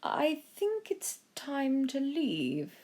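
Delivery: slow, measured butler; calm Jenkins. A narrator's reading. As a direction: say it gravely and slowly. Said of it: "Jenkins said".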